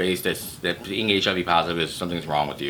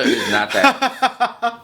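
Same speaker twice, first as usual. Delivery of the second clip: laughing; 14 semitones higher, 4 dB louder, much slower than usual. Real, not acted. Laughing at own joke.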